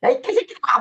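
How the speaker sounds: high-pitched imitation of an angry Jesus; angry rebuke. Lecturer acts Jesus cursing the rulers, angry high-pitched rebuke. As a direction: hurl the insult in mock fury. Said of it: "뭐 화내잖아".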